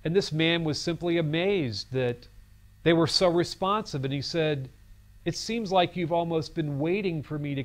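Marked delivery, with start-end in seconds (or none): none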